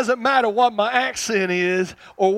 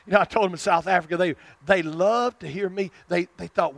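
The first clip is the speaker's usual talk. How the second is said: ordinary delivery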